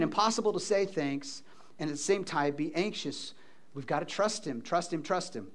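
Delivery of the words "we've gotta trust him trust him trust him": soft urging. Real, not acted.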